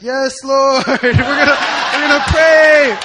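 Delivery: an eager shouted vow, slowing on pray; fervent yes. Retold or imitated. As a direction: answer the call to prayer, shouting. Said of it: "And I was like".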